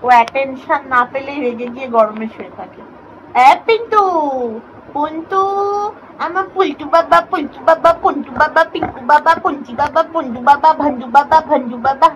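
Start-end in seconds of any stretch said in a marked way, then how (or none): 3.5-12.1 high cooing pet-name patter; doting, sing-song pet voice. Real, not acted. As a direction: coo a string of silly nicknames. Pet names cooed to the pet.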